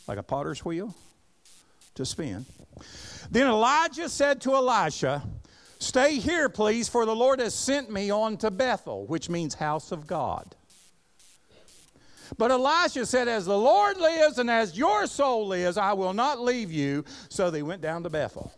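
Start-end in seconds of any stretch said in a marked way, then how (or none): 3.3-9.1 raised, ringing pulpit reading of scripture. Acted. Reading scripture aloud in sermon. 12.4-17.0 raised, ringing pulpit reading of scripture. Acted. Reading scripture aloud in sermon.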